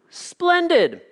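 a leap up into a high, gushing exclamation. Retold or imitated. Joke preacher delighted with the stranger's answer, high and gushing. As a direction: sound thrilled, way up high.

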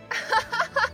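loud, very high ringing laugh; hearty laugh. A narrator's reading. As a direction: laugh out for the echoes. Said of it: "laughed loud and heartily".

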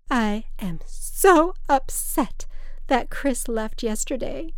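put-on slow, high, tearful drunk mother; a drunk mother weeping over her son-in-law; through tears. A narrator's reading. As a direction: wail it as if crying. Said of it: "she said through tears".

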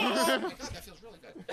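high, then whispered delight; surprised whisper. Retold or imitated. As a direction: gasp the pleasure out, laughing. Sudden pleasure.

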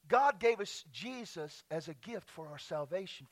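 normal talking voice

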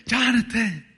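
high, pleading yell to open up; retold scream for help. Child trapped by car door screaming at brother for help. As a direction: call out high and desperate for help. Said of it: "I'm screaming for help".

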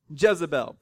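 ordinary delivery

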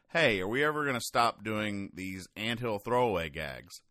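ordinary delivery

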